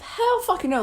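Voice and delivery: emphatic refusal. Real, not acted. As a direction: shoot it down loudly.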